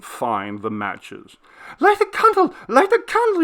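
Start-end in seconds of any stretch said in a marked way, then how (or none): 1.8-3.4 put-on much higher and louder, cracking like a boy's; frantic, broken pleading; voice breaking in terror. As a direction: plead desperately for the candle. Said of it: "he said, and his voice broke".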